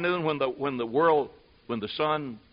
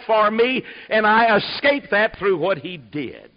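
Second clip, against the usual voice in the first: fiery preaching; 5 semitones higher, 6 dB louder than usual. Acted. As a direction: preach it to the back row, hammering every all. Preaching at full force.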